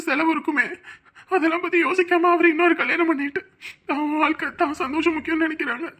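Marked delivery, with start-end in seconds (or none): none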